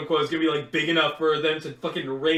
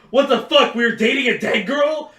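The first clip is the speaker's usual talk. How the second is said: shocked; 6 semitones higher, 6 dB louder than usual. Retold or imitated. Discovering she died before.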